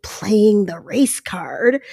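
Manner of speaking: slow whisper around the phrase; whispered quote. Retold or imitated. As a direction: breathe it like a scare quote. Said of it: "quote unquote".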